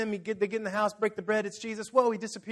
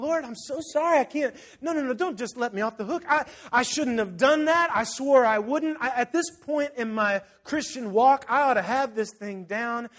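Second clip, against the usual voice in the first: anguished pleading; 5 semitones higher, 5 dB louder than usual. Retold or imitated. Acting penitent pleading with God.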